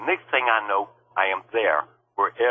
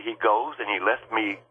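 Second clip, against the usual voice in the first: ordinary delivery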